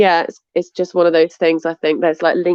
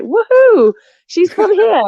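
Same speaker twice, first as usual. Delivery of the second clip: high, louder whoop as imitated fans; mock-excited cheer; 11 semitones higher, 4 dB louder, slower than usual. Imitating followers who think she's local, excited whoop. Acting out her followers' delighted reaction.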